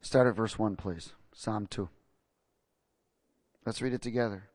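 ordinary delivery

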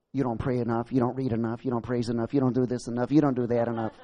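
low, lightly voiced accusing devil; hint of a Wicked Witch taunting. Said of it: "Sounds like the witch from the Wizard of Oz".

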